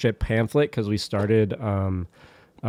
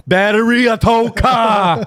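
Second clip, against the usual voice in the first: slow, high shouted call-out; mock shout; 9 semitones higher, 5 dB louder, slower than usual. Tow service hollering about battery, mock shout. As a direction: bellow it as a comic announcement. Acting out the AAA callers.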